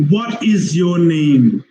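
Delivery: "What is your name" slow, word by word; slow teaching demo of "What is your name"; full uncontracted form. Shown as a demonstration. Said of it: "So if we say that".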